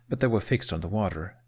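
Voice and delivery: ordinary delivery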